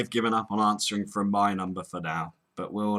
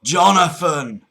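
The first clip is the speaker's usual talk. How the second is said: JONATHAN slow, louder and higher; emphatic correction; 4 semitones higher, 8 dB louder, slower than usual. Pretend. Cockney caller correcting the agent's hearing, loud and slow. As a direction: repeat the name insistently in Cockney. Agent keeps getting name wrong.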